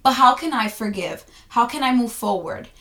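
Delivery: ordinary delivery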